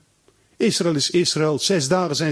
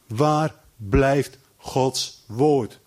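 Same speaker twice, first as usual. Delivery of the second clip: very slow, weighty question; slow rhetorical question; much slower than usual. Real, not acted. Preacher asks the congregation, very slowly. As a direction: let each word hang. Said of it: "Na tweeënvijftig jaar heeft hij een graf".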